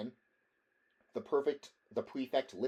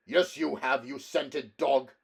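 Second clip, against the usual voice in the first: louder, slow contemptuous snarl; 4 semitones higher, 8 dB louder than usual. Acted. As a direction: spit the insult. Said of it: "snarled the Cimmerian".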